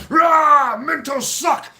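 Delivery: roaring, high-pitched angry shout; freaking out. Retold or imitated. Storyteller acting out his freak-out at finding another mint, roaring. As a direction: explode in a re-enacted fit of rage. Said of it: "I was just freaking out".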